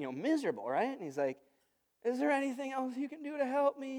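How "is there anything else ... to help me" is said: put-on higher, small pleading kid voice; plaintive little-boy voice; little child's voice. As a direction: imitate a sick little boy asking for help. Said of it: "in his little voice".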